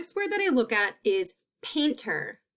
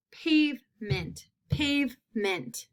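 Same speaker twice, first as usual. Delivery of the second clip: "pavement" said slowly, leaning on the long A; slow model word; 3 semitones higher, slower than usual. Shown as a demonstration. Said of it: "That long A sound".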